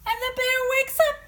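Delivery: high, fast, loud exclamation; sudden excited burst. A narrator's reading. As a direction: blurt it out in surprise. Sudden startled wake.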